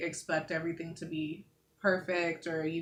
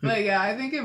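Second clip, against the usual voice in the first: laughing; 6 semitones higher, 12 dB louder than usual. Real, not acted. Laughing at the friend's joke.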